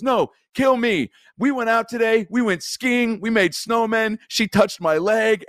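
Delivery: high slow pleading voice; Jeff Daniels impression. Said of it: "Jeff Daniels goes".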